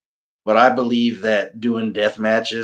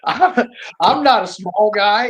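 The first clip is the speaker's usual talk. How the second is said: laughing through the words, raised pitch; 8 semitones higher, 3 dB louder, slower than usual. Real, not acted. Joking to the host about his weight, laughing. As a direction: chuckle while owning up to his size.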